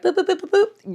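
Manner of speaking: quick, high-pitched boops; imitated phone beeps. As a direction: mimic tapping out a text. Imitating texting her friend.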